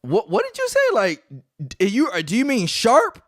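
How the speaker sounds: put-on high, incredulous kid voice; a five-year-old baffled by a word; son's confused reply. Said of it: "my son is like".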